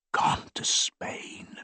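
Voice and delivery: breathy secret whisper, slow; whispered very low. A narrator's reading. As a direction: barely breathe the words. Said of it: "he whispered very low".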